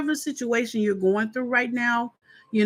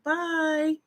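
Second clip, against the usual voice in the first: high, softer mock-sweet 'bye'; imitated goodbye; 8 semitones higher, 3 dB quieter than usual. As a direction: toss out a high-pitched, put-on farewell. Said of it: "she's like".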